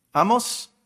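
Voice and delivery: "Amós" with the accent on the ó; "Amós" stressed at the end, lifted; stress on final syllable. Shown as a demonstration. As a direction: say "Amós", leaning on the last syllable. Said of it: "así se pronuncia".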